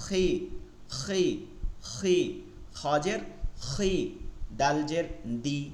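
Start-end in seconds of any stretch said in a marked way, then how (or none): none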